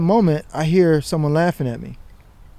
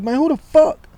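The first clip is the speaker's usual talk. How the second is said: exasperated high challenge; indignant; 12 semitones higher than usual. Retold or imitated. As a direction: spin round and call it out. Spinning round again, indignant.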